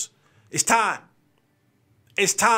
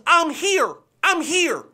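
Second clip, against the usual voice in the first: high, fired-up mock snarl of a furious quarterback; angry Patrick Mahomes; 7 semitones higher, slower than usual. Playing Mahomes for his listeners, high angry declaration. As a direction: bark it up high like a pissed-off star. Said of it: "angry Patrick Mahomes".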